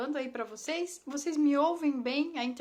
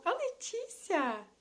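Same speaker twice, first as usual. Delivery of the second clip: surprised recognition; 6 semitones higher, slower than usual. Real, not acted. Spots an old classmate in chat.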